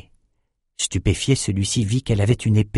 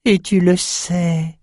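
slow, high sneering jab; grating taunt; 8 semitones higher, much slower than usual. A narrator's reading. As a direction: let the skull's mockery creak out. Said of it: "grinça Maxime".

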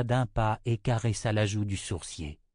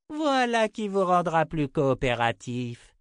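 high, gloating witch's triumph; jubilant; 7 semitones higher than usual. A narrator's reading. Six to her tortured prisoners, jubilant. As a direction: crow over helpless victims, high-pitched. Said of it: "jubila la voyante".

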